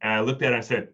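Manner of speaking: ordinary delivery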